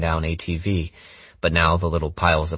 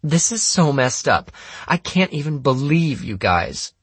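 high-pitched, exasperated outrage; indignant girl; 10 semitones higher than usual. Acted. Anna rails at the group over missing money, indignant.

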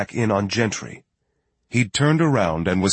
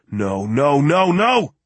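rising from usual pitch to a high cry; mounting alarm; 6 semitones higher, 4 dB louder, slower than usual. A narrator's reading. Lloyd understanding Riegel's worry, mounting alarm. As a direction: build each no louder and higher. Lloyd realises target will jump.

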